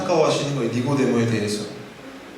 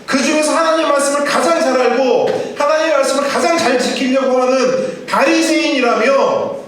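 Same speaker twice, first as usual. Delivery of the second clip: higher incredulous chiding list; Jesus, amazed, reminds Nicodemus of his titles; astonished Jesus; 8 semitones higher than usual. Retold or imitated. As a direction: pile up his titles in disbelief. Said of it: "깜짝 놀라면서 말씀하셔요".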